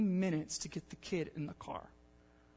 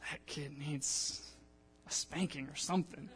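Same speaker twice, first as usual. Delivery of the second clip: muttered judgment; 4 semitones lower, 8 dB quieter than usual. Retold or imitated. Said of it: "standing out at a distance and being like".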